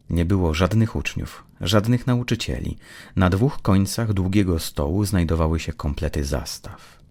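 plain reading voice

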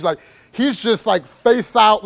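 ordinary delivery

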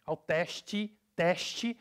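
teste said "tesh-chi"; Brazilian pronunciation. Shown as a demonstration. Teacher introducing another change, teste as "tesh-chi". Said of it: "This is another thing".

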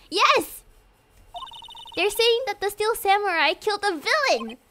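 high, urgent reporting; agitated excitement. Acted. Maya telling Nick about the killing, agitated. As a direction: press the shocking news on your listener.

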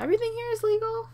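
fast, high fake-innocent question; mock innocent. Player fooling the cops, mock-innocent high voice. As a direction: play dumb for the cops, high and quick. Cops arrive at Sims party.

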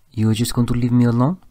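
put-on French-accented man, rising question; thick French accent. A narrator's reading. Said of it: "he asked in his thick accent".

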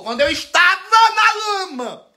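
indignant disbelief. Acted. Vlogger echoing a plea for help, indignantly incredulous. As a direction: throw the phrase back way up high, scandalised.

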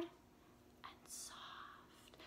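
soft whisper to show soft. Shown as a demonstration. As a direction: drop to a whisper to demonstrate soft. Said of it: "when we play something loud".

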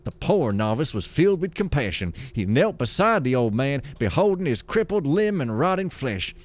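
lively, sing-song storybook reading; story narration. A narrator's reading. Gamer reading lore aloud as a tale, storytelling voice. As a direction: read it like a grand old fable.

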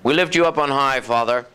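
lifted-up prayer to the Father; a touch of worship in the voice. Acted. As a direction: pray it out, reverent and lifted. Preacher prays aloud.

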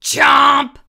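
loud snapping chomp; chomp shout. A narrator's reading. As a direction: bite the word off loudly. Goldfish bites.

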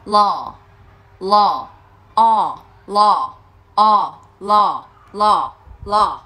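"Lò" as English law, long, open and falling; long open vowel. Shown as a demonstration. Teacher models the word for learners, "lò" long and open. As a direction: draw out the open ah in "lò" and "o". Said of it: "vẫn là nguyên âm A hai chấm thôi".

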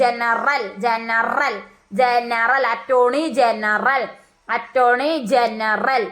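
"ജനറൽ" said slowly, leaning on the "റ" in the last syllable; stressed R sound. Shown as a demonstration. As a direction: stretch "ജനറൽ" out so the "റൽ" ending stands out. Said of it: "ആറിലാണ് അവസാനിക്കുന്നത് അല്ലേ?". ("ജനറൽ" എന്നതിലെ "റ" ഊന്നി, പതുക്കെ നീട്ടിപ്പറയുക)

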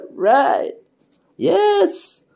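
high loud exclaiming; excited. Real, not acted. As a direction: shout it out triumphantly. Mock triumph at result.

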